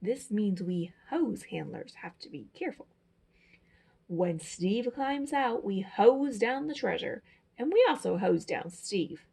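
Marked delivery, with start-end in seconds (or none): none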